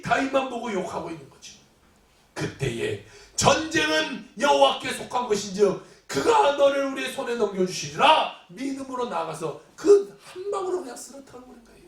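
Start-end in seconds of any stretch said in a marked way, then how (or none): 3.4-8.4 triumphant declaration. Acted. David answering Goliath in faith, shouted proclamation.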